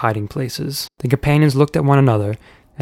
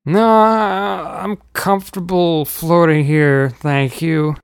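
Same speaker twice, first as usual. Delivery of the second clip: uncle voice; 5 semitones higher, slower than usual. A narrator's reading. Uncle declining nervously.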